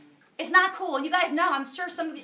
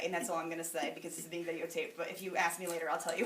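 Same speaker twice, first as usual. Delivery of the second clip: normal talking voice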